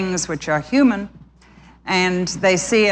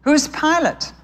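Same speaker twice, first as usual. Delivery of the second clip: much higher, curious small bird voice; the little brother sparrow asking innocently; 9 semitones higher, slower than usual. A narrator's reading. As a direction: ask it like a wide-eyed little sparrow. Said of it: "asked his brother".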